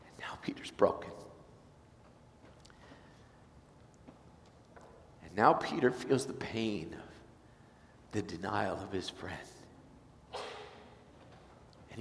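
soft, slow whispered telling; hushed narration. A narrator's reading. Preacher telling Peter's pain, hushed and slow. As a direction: draw the listener close and slow.

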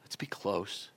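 soft whispered invitation; tender whisper. Acted. Jesus inviting Peter to be close, tender whisper. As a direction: breathe it gently like a promise.

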